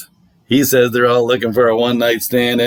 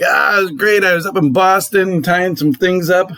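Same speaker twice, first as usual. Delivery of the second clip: put-on Boston accent; 7 semitones higher than usual. Neighbor bragging about his Boston weekend in a mock Boston accent. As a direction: brag in an imitated Boston accent. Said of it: "He's got this Boston accent that's great".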